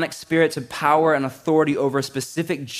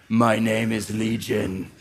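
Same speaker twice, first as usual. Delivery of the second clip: demon voice; 4 semitones lower, slower than usual. Acted. Preacher voicing the demon.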